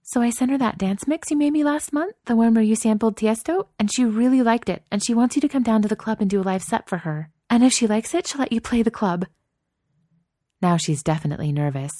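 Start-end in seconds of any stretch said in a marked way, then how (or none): 0.0-9.3 in a rush. Acted. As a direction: blurt it all out before interrupted. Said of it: "Chloe says the rest in a rush".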